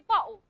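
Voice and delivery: "bottle" with the t swallowed into a glottal stop; Cockney glottal stop. Shown as a demonstration. Teacher repeats the London example, "bottle" with a glottal stop. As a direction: drop the t in "bottle", catch it in the throat. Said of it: "They use a glottal stop".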